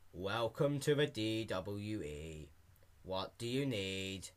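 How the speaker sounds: low, soft, slow flat greeting; bored clerk monotone. Acted. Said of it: "monotone and with a bad attitude".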